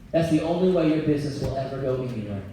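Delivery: ordinary talk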